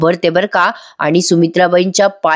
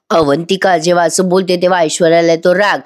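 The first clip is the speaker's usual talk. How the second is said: usual speaking voice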